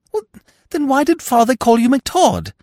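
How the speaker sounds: high, loud bewildered question; high-voiced young fool, utterly puzzled; baffled Freddy. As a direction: demand it in a high, silly voice. Baffled Freddy demands explanation.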